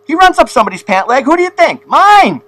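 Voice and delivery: rising to a shout at the punchline; excited. Real, not acted. Excited climax of squirrel story.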